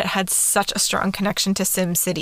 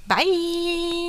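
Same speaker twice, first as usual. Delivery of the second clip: voice cracking up an octave; 9 semitones higher, 3 dB quieter than usual. Real, not acted. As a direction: voice cracks high on the word. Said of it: "My voice cracked again".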